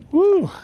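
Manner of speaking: short high "woo" letting emotion out; loud, high-pitched exhale of a whoop; emotional whoop. Real, not acted. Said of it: "Got the tears through the glasses".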